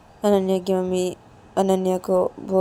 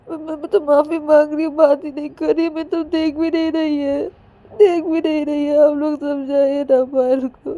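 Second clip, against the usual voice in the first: high, louder crying complaint with stammered repeats; acted tearful pleading; 6 semitones higher, 5 dB louder than usual. Ananya begging mothers to talk Payal round, tearful. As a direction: plead through sobs, whining to the grown-ups. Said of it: "रोए जा रही थी बहुत ही।".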